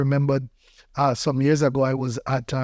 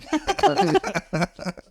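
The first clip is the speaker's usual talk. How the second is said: high burst of laughter; amused, high-pitched laughing fit. Real, not acted. Amused at outgrown pants story.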